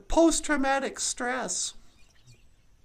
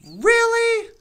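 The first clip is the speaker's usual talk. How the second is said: loud, higher Really? in disbelief; incredulous; 7 semitones higher, 9 dB louder than usual. Real, not acted.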